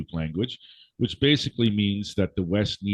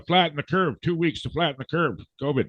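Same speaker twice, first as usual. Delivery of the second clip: higher, sing-song parody of an official slogan; mocking covid slogan; 8 semitones higher than usual. Host mocks covid officials' slogan in a higher sneering voice. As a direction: parrot the slogan with scorn. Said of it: "I heard this when".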